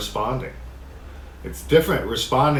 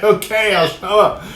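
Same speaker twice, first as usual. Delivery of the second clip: grudging; 9 semitones higher, 5 dB louder than usual. Pretend. Grudging compliance after annoyance.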